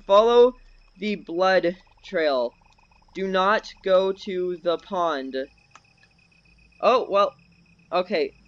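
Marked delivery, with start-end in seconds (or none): 0.1-5.4 very slow, word by word; deliberate instruction. Real, not acted. Telling teammates to avoid the pond, slow and emphatic. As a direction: spell out the order slowly. Slowly instructing the other players.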